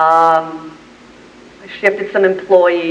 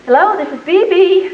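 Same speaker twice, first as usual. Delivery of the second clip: feigned composure; 9 semitones higher, 3 dB louder than usual. Retold or imitated. Answers phone after breakdown.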